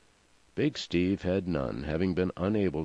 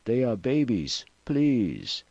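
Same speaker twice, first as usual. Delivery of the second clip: higher, urgent begging; anguished appeal, pitched up; desperate plea; 6 semitones higher, 4 dB louder, slower than usual. A narrator's reading. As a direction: implore them for the children's sake. Mother begs for her babies.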